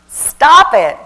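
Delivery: sharp, loud scolding command; firm stop. Real, not acted. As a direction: cut them off hard. Scolding the audience.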